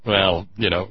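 ordinary delivery

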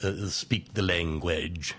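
lightly plummy English for great actors; a hint of stage British, slowing at the end; mild Shakespearean RP. Gently mocking the Victorian style of Shakespeare. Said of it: "great Shakespearean actors".

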